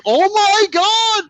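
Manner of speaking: very high, drawn-out, loud exclamation; mock horrified outcry. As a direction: wail it in exaggerated shock. Mock horror at a TV interview.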